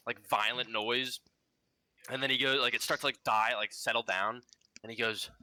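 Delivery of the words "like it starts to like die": normal talking voice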